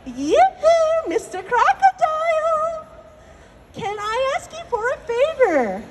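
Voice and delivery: high singsong yoo-hoo; rabbit calling out. Acted. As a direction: call sweetly and slowly in a high rabbit voice. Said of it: "Mister Rabbit goes".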